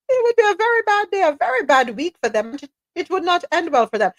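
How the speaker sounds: high, singsong mocking toddler talk. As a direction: imitate childish warnings in a high voice. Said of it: "Why is he talking like a toddler?".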